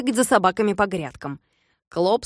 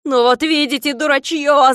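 loud, higher blaming shout; despairing yell; 6 semitones higher, 6 dB louder than usual. A narrator's reading. Klops after shooting his own dog, despairing yell. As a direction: blame the fools in despair. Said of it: "закричал Клопс, хватаясь за голову".

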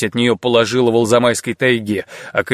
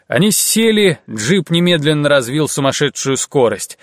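ordinary delivery

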